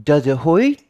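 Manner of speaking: put-on faint child voice, curious; a touch of a small girl's question; little girl voice. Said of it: "the little girl says".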